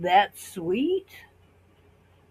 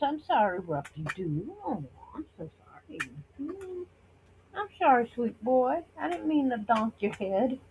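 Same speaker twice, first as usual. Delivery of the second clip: softer, tender sorry to the dog she bumped; soft apology to dog; 4 semitones higher, 6 dB quieter than usual. Real, not acted. To her dog after bumping his head, soft and tender.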